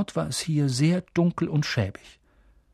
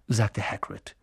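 plain reading voice

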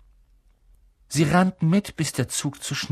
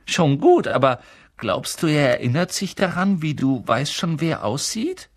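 teasing twin brother; 6 semitones higher, 4 dB louder than usual. Acted.

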